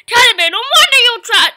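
high, very loud outburst; mock scolding yell. Player telling off the teddy bear, mock scolding yell. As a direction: yell it at the bear in play outrage.

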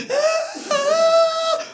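very high-pitched mock bawling; hammed-up child's cry; imitated squealing wail; crying cousin wail. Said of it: "If my cousin cried".

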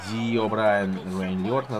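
ordinary talk, nothing marked

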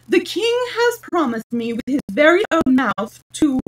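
loud, high-pitched pompous proclamation; grand boast. A narrator's reading. Humpty Dumpty repeating the king's promise to Alice, grandly. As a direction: proclaim it with mounting importance.

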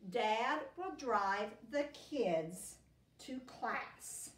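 slow, clear dictation voice; teacher dictating a spelling sentence. Shown as a demonstration. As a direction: read it slowly for the kids to write. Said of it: "Repeat it".

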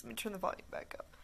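hushed whisper under game sound. Real, not acted. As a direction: whisper so the game stays audible. Whispers while game audio plays.

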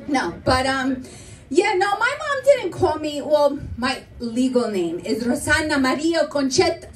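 ordinary delivery

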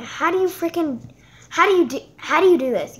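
loud frustrated whine; frustrated outburst. Real, not acted. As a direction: demand it, fed up with the app. Frustrated with the app.

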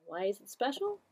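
usual speaking voice

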